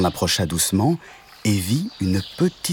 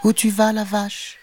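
high little kitten voice; high kitten question; 13 semitones higher than usual. Acted. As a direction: ask as the small tabby kitten. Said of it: "demanda-t-il".

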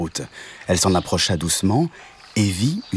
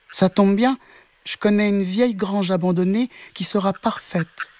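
much higher, helpful voice; little kitten; 12 semitones higher than usual. Acted. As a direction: kitten who knows a spot. Kitten offers barn.

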